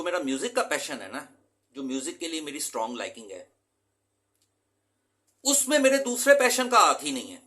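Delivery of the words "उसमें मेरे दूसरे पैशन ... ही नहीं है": emphatic. Real, not acted. Teacher stresses a surprising point.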